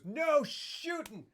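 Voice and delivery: a shopkeeper's drawn-out desperate cry to stop the shooting; dramatic cry. Retold or imitated. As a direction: plead it long and high, trying to stop the shooting. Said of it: "And Jesus goes".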